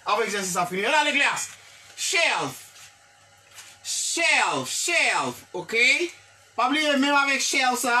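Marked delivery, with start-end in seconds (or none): none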